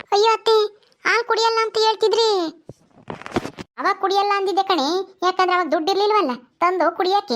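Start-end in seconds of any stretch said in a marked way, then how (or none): 0.1-2.5 high-pitched, taken-aback objection; surprised protest. Acted. Daughter-in-law questions mother-in-law's sudden milk demand, surprised protest.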